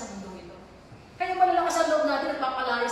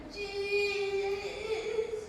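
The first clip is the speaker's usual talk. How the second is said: possessed cry; 4 semitones higher, 5 dB quieter than usual. Retold or imitated. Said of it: "Sabi niya".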